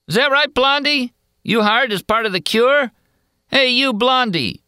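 high, mocking heckler voice; taunting loafer. A narrator's reading. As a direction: sneer the question up at her. Loafer keeps taunting the girl.

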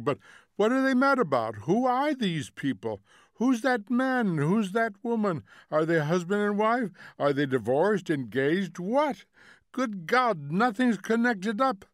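loud, high, incredulous questions; exasperated cry. A narrator's reading. Husband demanding who these people are, exasperated cry. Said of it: "cried Montague".

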